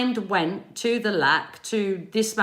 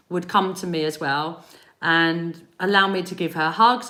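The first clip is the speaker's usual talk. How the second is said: ordinary talk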